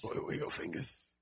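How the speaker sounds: whispered echo of the question; mock whisper. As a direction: breathe it back as a playful whisper.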